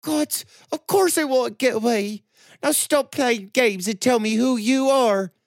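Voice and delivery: put-on high, loud and demanding young woman; angry. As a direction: snap at him to stop playing games. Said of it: "Anger replaced the tears".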